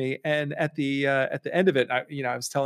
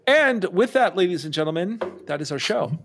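show sign-off; 4 semitones higher than usual. Acted. Host signs off.

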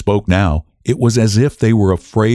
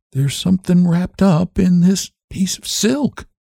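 higher, slower, hesitant words; faltering; 11 semitones higher than usual. Acted. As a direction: let the words falter as dread grows. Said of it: "faltered Missus Hale".

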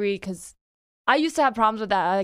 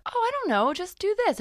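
high, airy, unbothered voice; mock-serene, lilting dismissal; calm brush-off; 10 semitones higher than usual. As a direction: imitate someone breezily calm, pitched way up. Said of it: "so calmly says".

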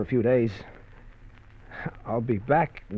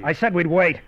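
louder, higher, pushing back hard; raised voice, defending himself; angry insistence; 6 semitones higher, 5 dB louder than usual. Acted. Heated quarrel over car keys.

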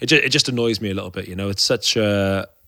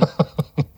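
short laugh; 6 semitones higher than usual. Real, not acted. Laughs at not recommending drinking.

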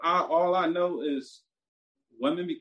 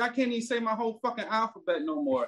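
retold fed-up rant; 7 semitones higher than usual. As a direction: vent like you've had enough. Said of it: "That's how fucking mad I was".

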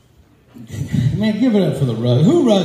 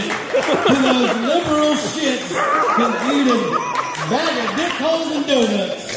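mock rant; 11 semitones higher than usual. Comic's mock rant at liberals.

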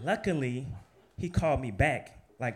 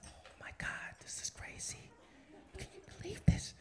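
breathless whisper of disbelief; hushed, amazed whisper to himself; 9 dB quieter than usual. Retold or imitated. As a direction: whisper it like a secret thrill.

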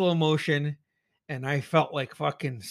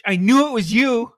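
triumphant shout; 9 semitones higher, 11 dB louder than usual. Real, not acted. Yelling at unmasked killer.

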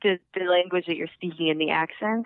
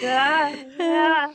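high, loud ghostly moan; 8 semitones higher, 4 dB louder than usual. Retold or imitated. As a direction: moan like a ghost stuck in a chamber. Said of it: "sounds like a ghost".

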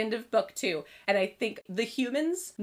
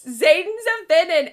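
mock shocked excitement; 8 semitones higher, 9 dB louder than usual. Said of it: "I was like".

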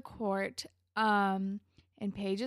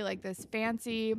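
ordinary talk, nothing marked

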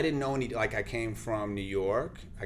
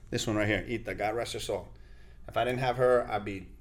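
usual speaking voice

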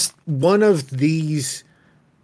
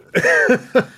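burst of laughter; 12 semitones higher, 4 dB louder than usual. Real, not acted. Laughs at cohost's joke.

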